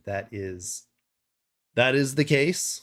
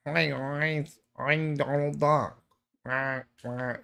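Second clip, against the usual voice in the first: failed Donald Duck impression; 2 semitones higher, 5 dB quieter, much slower than usual. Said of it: "No, I can't do it".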